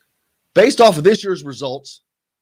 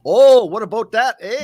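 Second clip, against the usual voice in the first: louder, higher mock Wisconsin "what about that"; Wisconsin accent; 5 semitones higher, 6 dB louder than usual. Host mimicking Northwoods locals, in a hammed-up Wisconsin accent. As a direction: ham up the Northwoods Wisconsin vowel. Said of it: "you hear it up there".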